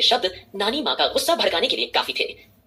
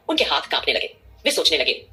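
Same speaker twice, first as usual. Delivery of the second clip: usual speaking voice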